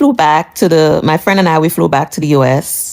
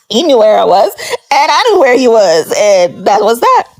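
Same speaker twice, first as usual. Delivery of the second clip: laughing; 4 semitones higher than usual. Real, not acted. Amused telling viewers how they dodged each other. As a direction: laugh while you say it.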